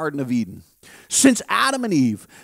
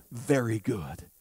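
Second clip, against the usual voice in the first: low, much softer approval; soft divine blessing; God's quiet approval; 11 semitones lower, 11 dB quieter than usual. Acted. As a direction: say it hushed and tender.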